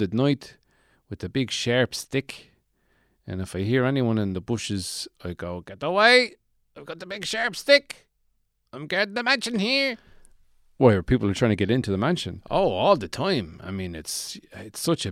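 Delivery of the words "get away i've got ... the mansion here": high-pitched yell to scare off intruders; warning shout. Acted. Shack-dweller to someone in the bushes at night, warning shout. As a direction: shout it high, guarding your patch.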